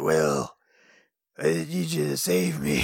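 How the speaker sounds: low, listless damsel plea; limp, bored cry for help; bad tired voice. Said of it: "a bad, tired voice".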